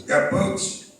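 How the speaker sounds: put-on high gruff old boss voice, laughing; gruff old man. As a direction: bark it like a crusty old boss. Gruff old boss asked for boots.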